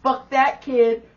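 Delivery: imitated seething outburst; furious little boy. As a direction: punch out each curse as the enraged kid. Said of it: "he was so mad".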